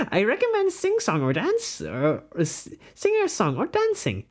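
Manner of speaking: high, swooping theatrical voice; put-on character voice. Streamer reads quiz advice in a high put-on voice. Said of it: "let me do this in a voice".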